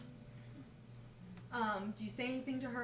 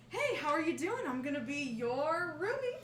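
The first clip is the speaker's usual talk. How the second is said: higher, eager greeting through a wide smile; excited roommate; 5 semitones higher than usual. Acted. New roommate leaning over to greet Randy, excited. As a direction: bound in as an overfriendly new roomie. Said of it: "wide smile and excited eyes".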